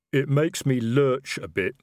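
a troll fumbling for words in his suit; slow troll. A narrator's reading.

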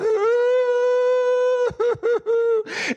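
long high wailing cry, half sob, half laugh; retold crying wail. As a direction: let out a high, overwhelmed wail. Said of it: "Why are you crying?".

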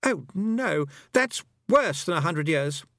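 put-on high-pitched boyish dismay; frightened boy. A narrator's reading. Roland learning nearly a year passed, frightened high boy. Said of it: "he looked really frightened".

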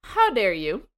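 high, loud burst, then dropping on "ma'am"; hammed-up affront; mock offended. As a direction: act out playful indignation. Acts out mock offence at remark.

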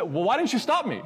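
high, loud indignant question; retold accusatory outburst; mad, blaming. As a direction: imitate a friend angrily shifting the blame. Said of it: "he was just so mad at himself and mad at me".

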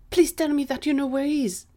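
high, fast, loud begging; urgent plea. Acted. Gary begs Ali about missing Gwen, urgently. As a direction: implore in a hopeful rush.

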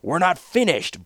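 angry. Acted. Wagon master cuts in angrily.

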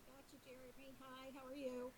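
speaking far too quietly into the phone; talking low. Real, not acted. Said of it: "I'm talking a little low".

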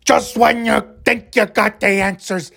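high, strained promo with huge gasps; Roddy Piper. Acted. Wrestler promises trouble at WrestleMania, overwrought impression. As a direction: gasp between lines like you'll pass out. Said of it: "that's how he talks".

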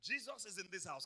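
ordinary talk

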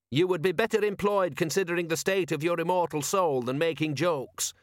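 high-pitched, pious reproof; severe. A narrator's reading. Pious constable rebuking a joker, severely. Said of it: "said Constable Visit severely".

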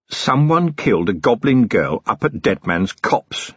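plain reading voice

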